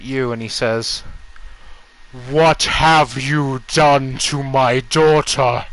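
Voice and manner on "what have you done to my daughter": slow, loud, wrathful accusation; menacing tone shift. Acted. Grieving father looks up at the party, slow thunderous accusation. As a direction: turn on them, each word heavy and loud. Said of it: "as the tone shift happens".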